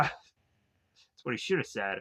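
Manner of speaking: normal talking voice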